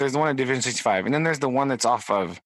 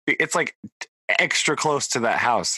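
normal talking voice

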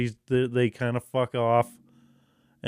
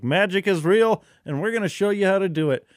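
higher, louder, rushed announcement; mock grand proclamation; 7 semitones higher, 4 dB louder than usual. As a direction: sell the wonder of it, fast. Imagined proclamation.

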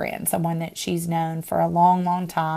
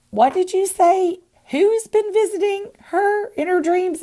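much higher, incredulous questioning; high-pitched double take, as if re-enacting the shock; retold astonishment; 13 semitones higher, 3 dB louder than usual. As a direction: squeal the question in disbelief. Retells own stunned reaction on phone.

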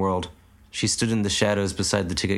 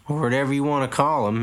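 put-on higher old farmer voice; 6 semitones higher than usual. A narrator's reading. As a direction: grumble it like a tired old man. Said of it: "added the old man".

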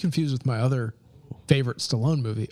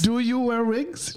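high, slow fake German accent; hammy German interviewer voice; 8 semitones higher, slower than usual. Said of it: "doing a German accent".

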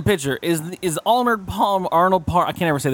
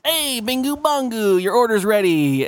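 server voice; 7 semitones higher than usual. Retold or imitated.

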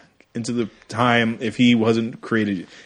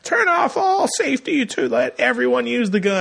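put-on much higher, crying shout of a frantic kid; mock villain shout; 12 semitones higher than usual. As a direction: yell the command like a desperate mock villain. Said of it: "And he's like".

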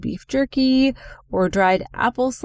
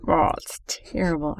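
ordinary talk

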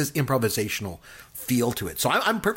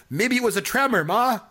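higher, innocent-sounding guess; goofy son's reply; 8 semitones higher than usual. Retold or imitated. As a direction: play the dim son offering an excuse. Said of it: "And then he goes".

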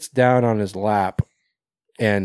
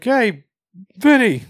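calling out; 11 semitones higher, 5 dB louder, slower than usual. Retold or imitated. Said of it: "he's like".

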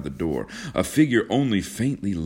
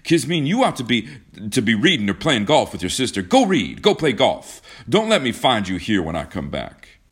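loud, raised scolding; gruff orders; 5 semitones higher, 5 dB louder than usual. A narrator's reading. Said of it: "he demanded gruffly".

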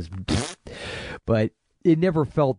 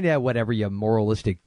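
ordinary talk, nothing marked

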